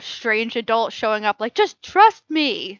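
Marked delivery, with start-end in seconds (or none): 1.5-2.8 slow, higher, louder "just trust me"; mock creepy stranger, drawn out; mock shady stranger. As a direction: stretch it out like a dodgy adult luring kids. Imitating strange adult.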